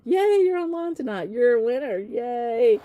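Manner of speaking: high, loud happy cheer. Real, not acted. Host spotting a winner online tonight, cheering.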